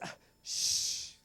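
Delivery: long, hissed shush. Retold or imitated. Girl shushing the boy behind her, hissed. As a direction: shush him sharply with a long "shh".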